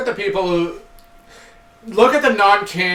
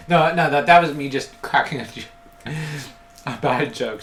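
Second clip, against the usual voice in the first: ordinary delivery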